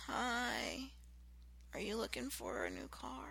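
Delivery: soft, limp sales greeting; bored quiet salesman; low-energy greeting. Shown as a demonstration. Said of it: "It's totally different".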